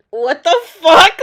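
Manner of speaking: loud, slow what the fuck; exaggerated disbelief; mock outrage. Real, not acted. Vlogger reacts to followers' advice with loud mock outrage.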